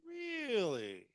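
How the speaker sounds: REALLY held long, high and loud, then falling; drawn-out last syllable. Shown as a demonstration. Mimicking the family habit of drawn-out last syllables. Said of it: "specifically our last syllables".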